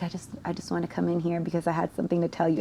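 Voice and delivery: soft, rushed retold explanation; hesitant, quick, hushed approach to dad; nervous confession. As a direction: nervously stammer it out quietly. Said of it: "I'm, like, nervous".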